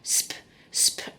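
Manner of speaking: "sp" whispered, no voice; whispered sound. Shown as a demonstration. Phonics teacher whispering the sp sound to children. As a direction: breathe out "sp" softly. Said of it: "So we've got sp".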